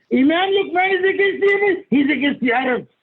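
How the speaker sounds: high-pitched mimicry of outraged critics; mock complaint, pitched well above normal; mimicked accuser. Mimicking critics who misread him.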